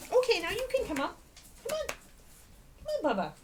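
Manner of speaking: quick high invitation; coaxing the dog. Real, not acted. Owner invites dog up in quick high chirp.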